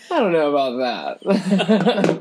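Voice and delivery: slow, laughing doubt. Real, not acted. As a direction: tease him through a laugh. Laughing at the claim.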